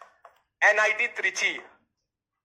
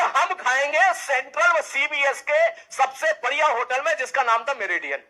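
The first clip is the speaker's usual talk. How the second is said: cheeky demand; 5 semitones higher, 5 dB louder than usual. Retold or imitated. Juniors demanding fancy hotel treat.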